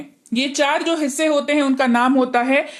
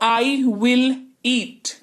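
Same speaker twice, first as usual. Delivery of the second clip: very slow drill; much slower than usual. Shown as a demonstration. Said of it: "future में will का इस्तेमाल होता है".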